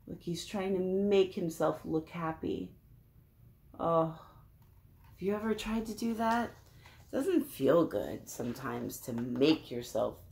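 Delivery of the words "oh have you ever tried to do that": ordinary delivery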